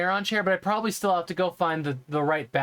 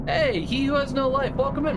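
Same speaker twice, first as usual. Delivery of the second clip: excited greeting; 9 semitones higher than usual. Real, not acted. Cheerful greeting to a viewer.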